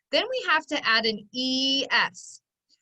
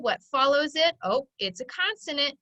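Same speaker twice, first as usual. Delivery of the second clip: ordinary talk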